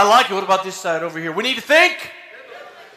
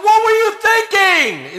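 much higher, half-mock stern father; scolding dad; 12 semitones higher, 3 dB louder than usual. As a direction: play the dad, exasperated. Father scolding in retold story.

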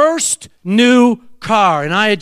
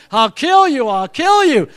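high chanted threat, punched on KILL; threat ringing in head; 5 semitones higher than usual. Retold or imitated. Preacher getting crowd to ring it, chanted threat. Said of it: "ring it with me".

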